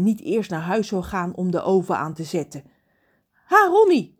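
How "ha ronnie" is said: bright, higher, louder greeting; cheerful mother. Acted. Mother happily answering her son's call, higher and louder. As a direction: greet him gladly, voice up high. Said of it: "zijn moeders stem klinkt vrolijk".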